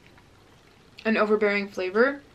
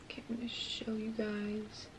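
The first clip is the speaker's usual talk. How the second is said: whispering; 16 dB quieter than usual. Real, not acted. Quietly showing food on camera.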